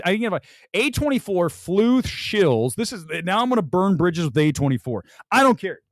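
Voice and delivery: ordinary delivery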